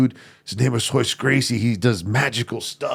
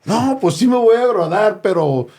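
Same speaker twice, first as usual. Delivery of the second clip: high, louder mock-pleading kid; hammed-up nervous backpedaling, pitched way up; pleading excuse; 9 semitones higher, 5 dB louder than usual. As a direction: squeak out an excuse to an angry dad. Acts out excuse to angry dad.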